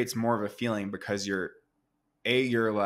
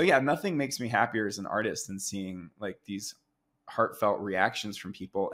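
normal talking voice

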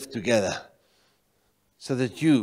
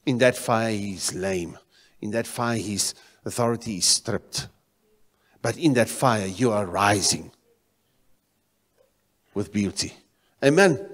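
ordinary delivery

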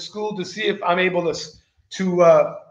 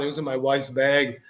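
normal talking voice

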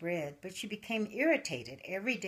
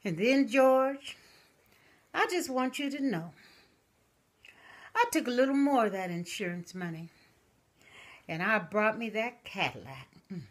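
put-on old widow to urn; 6 semitones higher, 9 dB louder than usual. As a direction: croon it to her late husband's urn. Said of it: "And she said".

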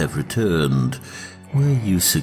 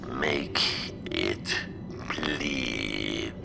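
dark lord; 4 semitones lower, 11 dB quieter, much slower than usual. Acted. Sith master's final command.

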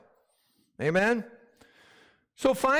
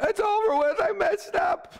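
high, whiny imitation of a quitter; imitating someone who gave up; mock despairing voice; 12 semitones higher than usual. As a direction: pitch it up like a defeated believer. Congregant's inner voice quoted.

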